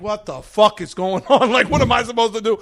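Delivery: laughing disbelief. Retold or imitated. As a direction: lose it mid-chaos, half laughing. Narrator panicking, laughing.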